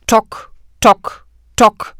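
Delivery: loud hollow tock tock knocks; knocking sound. A narrator's reading. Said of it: "Das Geräusch wurde jetzt lauter".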